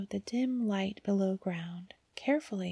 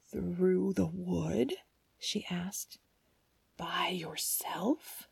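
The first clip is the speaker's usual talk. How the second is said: put-on slow, hushed old woman asking; old woman worrying over the girl; much slower than usual. A narrator's reading. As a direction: question her gently and slowly.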